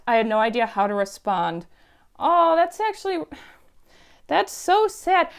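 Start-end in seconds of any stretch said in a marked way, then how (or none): 2.2-5.3 high-pitched pitying reaction; sympathetic dismay. Real, not acted. Reacting to a sad story online, sympathetic dismay. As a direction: let the sympathy lift your voice high.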